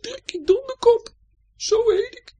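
very high, loud squeak; little fish voice. A narrator's reading. Tadpole fish telling Chappie its name, high squeak. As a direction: squeak it proudly like a baby fish. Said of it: "zegt het beestje".

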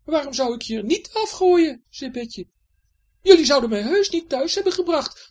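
high, loud scolding voice; Betje, stern housekeeper. Acted. Betje scolding the pirate about the mess, stern. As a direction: tell the pirate off firmly.

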